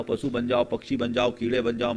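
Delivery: normal talking voice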